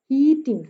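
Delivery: Heating with long, strong ही and light टिंग; trochaic stress. Shown as a demonstration. Teacher gives trochee example, first syllable of heating stressed. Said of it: "पहला stress है".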